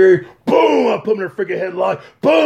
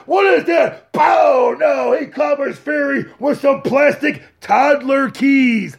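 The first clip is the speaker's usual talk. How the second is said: excited play-by-play; 5 semitones higher, slower than usual. Acted. Commentator calling the upset for the fans, shouting. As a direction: call the match live, shocked.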